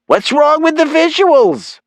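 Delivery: very high, loud, drawn-out protest; indignant mother. Acted. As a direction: squawk it as an affronted mom. Mother protests to her son.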